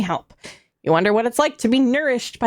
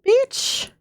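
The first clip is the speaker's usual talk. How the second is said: silly put-on voice; 9 semitones higher, slower than usual.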